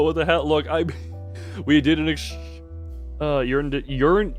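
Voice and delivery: usual speaking voice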